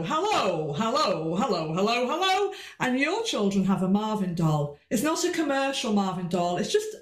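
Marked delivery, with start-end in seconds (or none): 0.0-2.5 playful puppet-show hello; big hello. Shown as a demonstration. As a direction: swoop each hello for the children. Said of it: "And I do a big hello".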